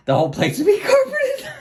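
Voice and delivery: high laughing burst. Real, not acted. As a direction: laugh through the word. Laughing about carpet.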